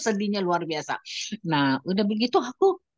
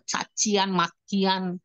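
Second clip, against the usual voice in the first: ordinary delivery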